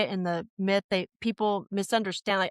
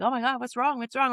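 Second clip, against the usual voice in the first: higher, fretful imitation of a startled dog; lightly voiced worried dog; 4 semitones higher than usual. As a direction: play a dog anxiously checking on its owner. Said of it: "he gets up like".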